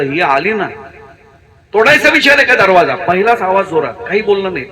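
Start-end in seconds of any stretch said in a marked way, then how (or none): 1.7-2.9 angry wife. Acted. Wife snaps at knock on door.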